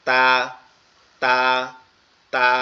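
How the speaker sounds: "তা" with tongue tip at the root of the front teeth. Shown as a demonstration. Teacher demonstrates "তা" for learners. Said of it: "জিহ্বার আগা সামনের দুই দাঁতের গোড়ার সঙ্গে".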